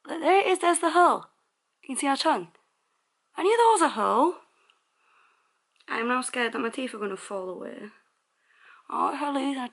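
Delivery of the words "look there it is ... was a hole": high alarmed voice; shocked at finding it. Real, not acted. Alarmed at hole in tooth.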